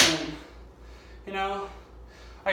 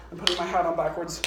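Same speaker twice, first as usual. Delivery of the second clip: usual speaking voice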